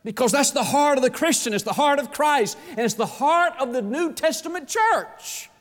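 rising to a high, shouted preacher's climax; full-force preaching. Real, not acted. Pastor urging the church to give, shouting the heart of Christ. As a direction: preach it out at the top of the voice.

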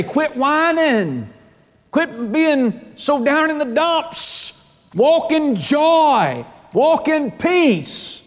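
high, slow, drawn-out command from God. Acted. God telling a gloomy believer to walk in joy, slow and high. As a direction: stretch each word high and slow like a loving command.

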